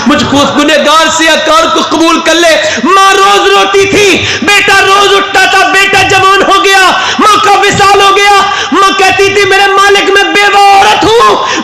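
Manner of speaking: preaching at full force. Acted. Preacher to the gathering, crying out at full force. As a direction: pour it out to the crowd as a rising wail. Preacher's climax on a weeping mother.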